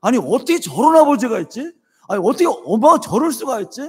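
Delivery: mock outraged young man; imitating the son's angry disbelief; son's indignation. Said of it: "화가 났더래요".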